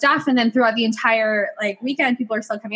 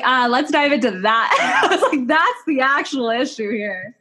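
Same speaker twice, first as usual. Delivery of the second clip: slow, drawn-out relish broken by laughter; gleeful retold aha, stretched out; amused pouncing; 3 semitones higher than usual. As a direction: laugh as you seize on the point.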